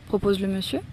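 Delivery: plain reading voice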